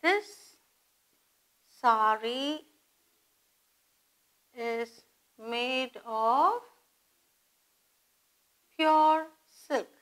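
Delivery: word by word with long pauses; slow dictation. Shown as a demonstration. Teacher dictating an example to students, slow dictation. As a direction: dictate it slowly, piece by piece.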